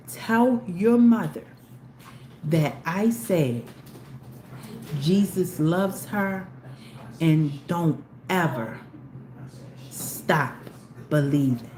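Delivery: slow, lightly voiced soft-spoken message; gentle blessing. Stranger's message for the mother, slow and gentle. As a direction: deliver it like a tender farewell blessing, slowly. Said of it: "she was so soft-spoken".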